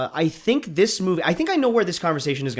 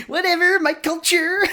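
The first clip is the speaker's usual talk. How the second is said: laughing. Real, not acted. Laughing at own joke.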